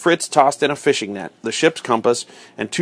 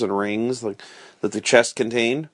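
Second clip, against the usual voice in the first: usual speaking voice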